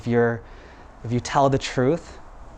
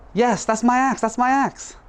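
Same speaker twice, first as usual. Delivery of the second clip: very high, insistent cries; hammed-up greedy eagerness, pitched way up; overeager claim; 11 semitones higher than usual. Dishonest woodcutter claims the silver axe, overeager.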